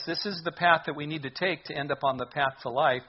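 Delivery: usual speaking voice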